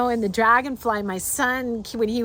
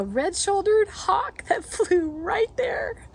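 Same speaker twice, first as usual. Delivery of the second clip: excited awe; 8 semitones higher, slower than usual. Real, not acted. Spotting a hawk mid-story.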